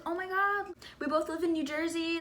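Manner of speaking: high-pitched imitated fangirl message; retold excitement. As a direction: pitch it up like a thrilled friend. Retells excited message from friend.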